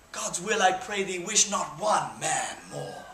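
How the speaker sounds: stirring theatrical recital of Henry the Fifth; rally the troops like a stage king; Shakespearean speech. Acted. Performs Henry's Crispin speech.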